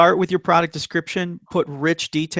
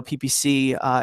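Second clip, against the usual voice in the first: normal talking voice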